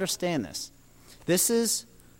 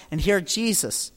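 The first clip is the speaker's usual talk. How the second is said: usual speaking voice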